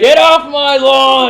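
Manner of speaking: put-on loud, high cranky old man shout; old man yelling. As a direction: bellow like a cranky grandpa. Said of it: "Old man Ian".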